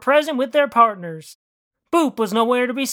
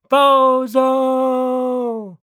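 drawn-out jeer; 3 semitones higher, 4 dB louder than usual. Acted. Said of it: "they all cried".

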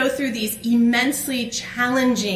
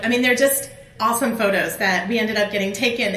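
usual speaking voice

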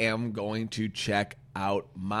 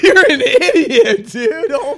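high laughing through the words; cracking up while calling him an idiot; 13 dB louder, slower than usual. Real, not acted. Laughing at friend's joke.

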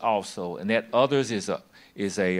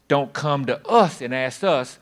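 usual speaking voice

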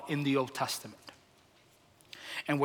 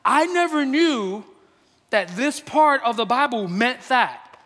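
higher, slow, bright mock delight; lightly voiced churchgoer with a sudden realization; playful imitation of a convinced listener, higher pitched; delighted logical thinker; 6 semitones higher than usual. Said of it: "the logical thinker walks out and says".

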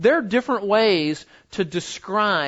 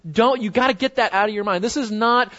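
usual speaking voice

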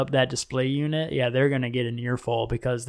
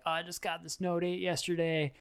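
higher, softer imitation of someone selling; a hint of a salesperson, pitched up and kept quiet; eager gadget pitch; 4 semitones higher, 8 dB quieter than usual. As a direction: lightly mimic a friend talking up a new phone. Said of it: "attempting to, like, sell me on the Note eight".

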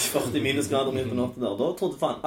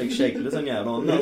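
ordinary talk, nothing marked

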